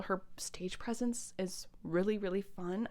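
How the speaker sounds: hushed, breathy whisper of delight. Real, not acted. Fan whispering about the singer's stage presence, hushed. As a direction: whisper it like a secret compliment. Gushing about the front woman.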